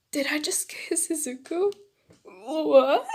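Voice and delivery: rising, incredulous gasp of a question; shocked. Acted. Girl to herself after an unexpected kiss, shocked.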